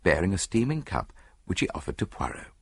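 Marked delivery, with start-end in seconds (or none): none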